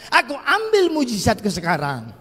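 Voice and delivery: loud confident claim of a miracle; loud bold declaration. Shown as a demonstration. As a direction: shout your faith out. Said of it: "masih kurang mantap".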